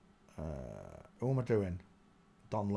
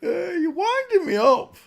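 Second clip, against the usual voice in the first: mock whine; 8 dB louder than usual. Frustrated at missing pictures.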